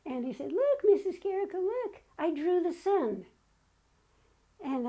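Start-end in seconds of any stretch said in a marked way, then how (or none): 0.5-3.2 put-on higher, eager child's voice; proud little kid showing off; little boy. Kindergarten boy showing his teacher his drawing, lightly voiced.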